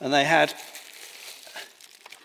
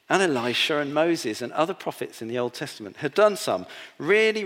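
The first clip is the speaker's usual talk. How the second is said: ordinary talk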